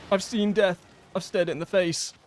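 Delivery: mock dramatic. Real, not acted. Hammy reaction after near death.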